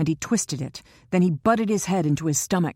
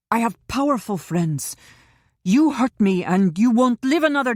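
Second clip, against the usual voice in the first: loud, higher defiant threats; suddenly defiant; 6 semitones higher, 5 dB louder than usual. A narrator's reading. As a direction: puff yourself up and threaten him. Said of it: "He was suddenly defiant".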